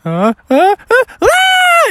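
stammered gasps building to a shriek; high, loud scream of comic fright; panicked gasps, then piercing yell; mock terrified scream. Neighbor sees bear, runs away.

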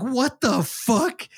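very slow, high-pitched mock outrage; retold bully, dragging out every word; indignant disbelief. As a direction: act out stretched incredulity with a rising finish. Bully challenged by Benson.

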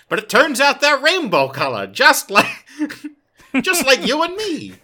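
very high, loud silly voice breaking into laughter; hammed-up squeal of cutesy delight; mock-sweet. Delivering the rainbow delegation punchline, high mock-sweet and giggly.

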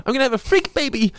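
high squeaky quote. Retold or imitated. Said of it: "quoting Celine's line".

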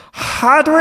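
high piercing call of the name; scream. Acted. [name] screaming at unconscious [name], high shriek. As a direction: shriek him awake. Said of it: "he screams".

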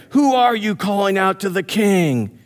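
high-pitched mock call back; imitated challenge called across a distance; retold shouted reply. Abner answers the shouted challenge.

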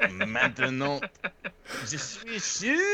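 high-pitched mock French; French accent. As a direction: put on a squeaky, silly French voice. Joking switch into French.

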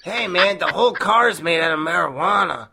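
put-on a light Chicano stoner accent; Cheech Marin stoner voice. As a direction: toss off a lazy Chicano stoner line. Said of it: "doing the Cheech Marin voice".

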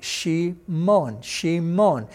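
Shimon slowly as SHEE, MON; syllable by syllable. Shown as a demonstration. Teacher linking the name to the root, syllable by syllable. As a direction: separate both syllables of Shimon, weight on MON. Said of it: "you see that same sound".

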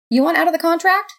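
high, rising echo of disbelief; incredulous question. A narrator's reading. God questions the roommate's demand to quit, incredulous.

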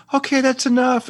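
imitating a high, campy voice; squeaky mock line; high falsetto. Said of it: "he goes".

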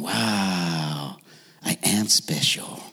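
soft, low whispered wow; awed whisper. Retold or imitated. Homeless-looking in hoodie, gets a quarter, whispered awe. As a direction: breathe it out in quiet disbelief. Stranger drops quarter in cup.

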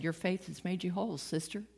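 soft, gentle blessing; tender reassurance. Acted. Jesus gently comforting the frightened woman, softly. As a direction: reassure her softly, like a kind brother.

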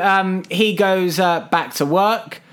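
usual speaking voice

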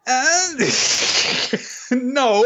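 high squeaky laughing no; a laughing, high-pitched no. Retold or imitated. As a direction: giggle out the no. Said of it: "I was like".

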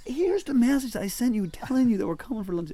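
high-pitched exasperated protest; mock exasperation. Traveller showing the guesthouse man his message, exasperated. Retold quarrel with guesthouse host.

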